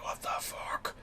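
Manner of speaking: unvoiced whisper of surprise; whispered disbelief. Realising the constable is the Mad Max villain, whispered shock. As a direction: breathe it out in stunned disbelief. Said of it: "I was just like".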